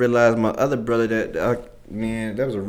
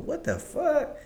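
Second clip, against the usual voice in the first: high, disbelieving squeak; stunned; 6 dB quieter, slower than usual. Retold or imitated. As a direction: let it shoot up in disbelief. Retold reaction to accusation.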